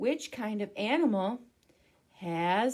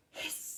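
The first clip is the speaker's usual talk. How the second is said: snake hiss; 11 dB quieter than usual. Pretend. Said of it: "the noise that I make is a".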